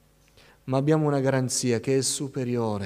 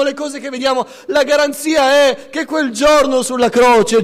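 loud, high, urgent sermon delivery; fiery preaching; 10 semitones higher, 10 dB louder than usual. Acted. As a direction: preach it out to the whole congregation. Preacher at full force.

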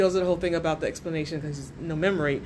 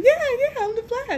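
very high, silly hero voice; mock Flash voice; 5 dB louder than usual. Co-host showing how Flash shouldn't talk, high mock voice. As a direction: squeal it way up high in mockery. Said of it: "don't say like this".

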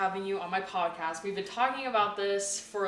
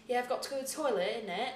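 higher-pitched reality-show British accent; Love Island British; 4 semitones higher than usual. Shown as a demonstration. American host showing off her Love Island British accent. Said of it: "my British accent is from Love Island".

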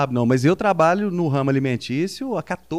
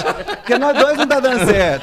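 laughing; 7 semitones higher, 4 dB louder than usual. Real, not acted. Joking swap proposal.

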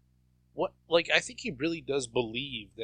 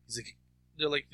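ordinary talk

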